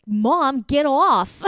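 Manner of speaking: put-on shrill, loud kid snapping at mom; high, loud childish snap; bratty child. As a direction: yell it like an annoyed kid. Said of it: "childhood tones will kinda come out".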